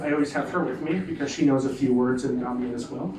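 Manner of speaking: ordinary talk, nothing marked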